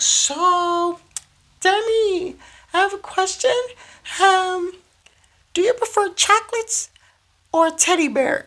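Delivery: very high, squeaky mock child voice; exaggerated high-pitched kid; imitated little girl. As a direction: put on a tiny girl's voice asking a question. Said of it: "there was a little girl".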